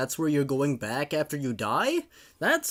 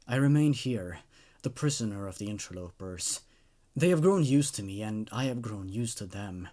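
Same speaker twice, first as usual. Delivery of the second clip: lower, hushed, mournful narration; dramatic story reading; 4 semitones lower, 4 dB quieter than usual. A narrator's reading. Narrator mourning his lost love, in a hushed, grieving read. Creepypasta read as first-person lament.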